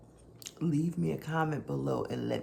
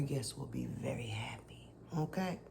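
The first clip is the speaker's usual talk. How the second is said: whispering; 4 semitones lower, 8 dB quieter than usual. Real, not acted.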